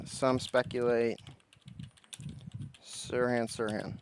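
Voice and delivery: very slow, stretched words, long pauses between; dictating while typing. Real, not acted.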